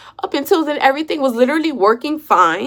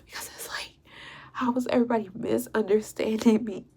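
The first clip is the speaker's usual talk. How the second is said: hushed, mostly voiceless complaint; hurt whisper; 9 dB quieter than usual. Real, not acted. Woman feels misunderstood by everybody, hurt whisper. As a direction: plead softly with the voice nearly gone.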